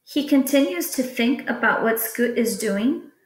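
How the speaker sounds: usual speaking voice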